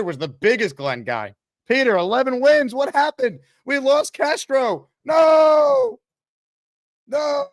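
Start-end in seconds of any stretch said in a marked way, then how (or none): 1.7-7.5 high, drawn-out wail rising to a loud "No"; hammed-up cry of despair; mock anguish. Host wailing over the team's loss, mock anguish.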